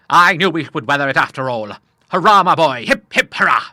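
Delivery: put-on loud, high, jubilant old seaman; bellowed cheers. A narrator's reading. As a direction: cheer out lustily for your boy. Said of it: "bellowed out three stentorian cheers".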